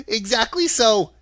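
high, tittering little man; self-satisfied, high old-man voice; pleased chuckle. A narrator's reading. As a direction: agree gleefully with a small giggle. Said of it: "as if it pleased him".

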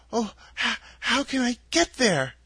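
put-on a small girl asking for help to get home; lightly voiced young girl Dorothy.

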